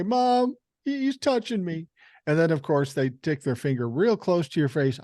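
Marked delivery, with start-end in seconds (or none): 0.0-1.9 put-on high whiny kid complaining to mom; whiny tattling little sibling.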